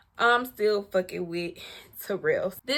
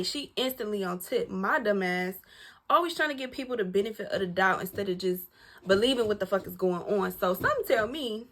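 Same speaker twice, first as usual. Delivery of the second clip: ordinary talk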